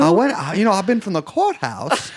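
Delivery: lightly put-on stump speech voice; mock Kamala speech voice. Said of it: "President Card voice".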